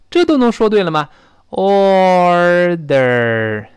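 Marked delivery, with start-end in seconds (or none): 1.5-3.7 "order" very slow, both syllables held; slow practice. Shown as a demonstration. Teacher showing students an easy start, very slow. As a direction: stretch "order" to twice its length. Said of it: "我先这么说".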